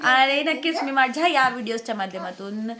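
normal talking voice

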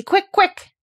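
very high, loud, eager hurrying; excited urging. A narrator's reading. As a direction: hurry them along with excitement. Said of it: "digging excitedly".

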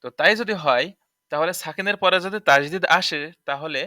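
ordinary talk, nothing marked

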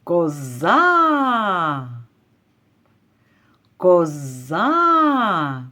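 коза with a long, falling final А; calling out. Shown as a demonstration. Teacher calls the goat to find the stress, drawing out коза's final А. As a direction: call коза, stretching the last syllable. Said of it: "Давайте покличемо ось цю козу".

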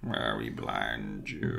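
very low, very slow croak; deep slow villain voice. Retold or imitated. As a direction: drag it out low like an old Sith. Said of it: "And he's like".

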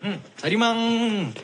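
drawn-out, high, wheedling call; playful coaxing. Acted. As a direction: stretch the name out high and slow to coax. Coaxing Jarim to go along.

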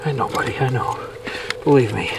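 low, soft, tender murmur; calm the struggling fish gently; soothing the fish. Real, not acted. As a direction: fisherman hushing his catch, soft and low.

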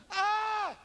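high mock yelp; movie scream. Mocking movie fright, high mock yelp. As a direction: fake a film scream. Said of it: "It's not like that".

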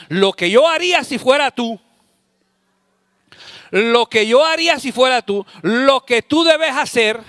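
lightly voiced advice monster, pushy; know-it-all advisor. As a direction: lecture them with smug certainty. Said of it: "el monstruo de los consejos".

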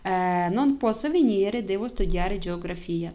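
slow, careful Italian with a slight push; slow model answer. Shown as a demonstration. Teacher checking the exercise with students, slow and careful. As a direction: lay out each Italian word for the class. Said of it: "Тепер перевіряємо".

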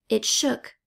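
usual speaking voice

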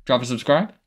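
normal talking voice

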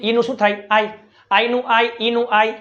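ordinary talk

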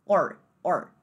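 or with a clear final R; American R. Shown as a demonstration. Teacher isolating the word or, American R. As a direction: curl the R of or, American-style. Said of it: "host family nativa americana".

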